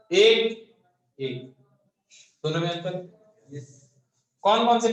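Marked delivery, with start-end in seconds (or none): 0.1-0.8 the word एक said loud and high, punched out; loud demo. Shown as a demonstration. Teacher demonstrating loudness to students, loud एक. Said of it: "जैसे अभी मैं एक बात बोलता हूँ". 1.2-1.4 the word एक said softly, low in volume; soft demo. Shown as a demonstration. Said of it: "जैसे अभी मैं एक बात बोलता हूँ".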